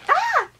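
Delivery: short, very high squeal of joy; excited squeal. Real, not acted. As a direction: let out a thrilled little shriek. Thrilled by sonic screwdriver.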